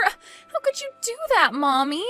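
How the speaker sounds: high-pitched dismayed protest; upset reproach. Acted. Bride confronts mommy over inviting Fatima back, upset reproach. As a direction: challenge her in high-pitched disbelief. Daughter reproaches her mother.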